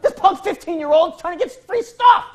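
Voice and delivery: high, loud indignant rant; loud outraged complaint; mock rant. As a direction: vent like a wronged adult. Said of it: "And I'm like".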